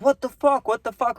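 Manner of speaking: high-pitched mock yell, no real volume; retold yelling. Grandpa, dad and uncle at the boat driver, mock yelling. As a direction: imitate relatives yelling in outraged disbelief. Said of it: "are all yelling at him".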